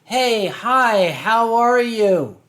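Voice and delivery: ordinary talk, nothing marked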